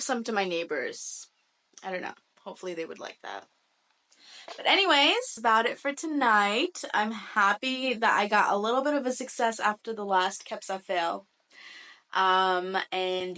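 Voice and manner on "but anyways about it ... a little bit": upbeat. Real, not acted. Wrapping up.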